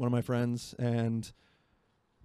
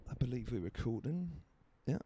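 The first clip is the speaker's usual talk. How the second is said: put-on British accent, understated delivery; casual mock-British accent; 4 dB quieter than usual. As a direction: play at being British, letting the accent wobble. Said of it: "that accent started, that started going away from British".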